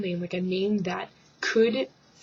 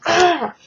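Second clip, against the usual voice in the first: sharp high yelp as he falls; 9 semitones higher, 10 dB louder than usual. Retold or imitated. Guy tripping in the video, high startled yelp. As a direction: cry out like you just tripped. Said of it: "He just like".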